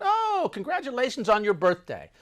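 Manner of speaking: high, loud, delighted outburst; hearty congratulations. Retold or imitated. Guest congratulates the birthday drinker, hearty and loud.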